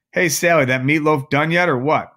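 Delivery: lazy husband shouting for his meatloaf; calling out. Acted. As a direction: bellow for dinner across the apartment. Gabe calling from living room.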